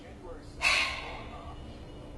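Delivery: long heavy exhale; slow breath out while waiting; sigh. Retold or imitated. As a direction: let out a big airy sigh. Resigned pause in the story.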